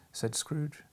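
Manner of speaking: plain reading voice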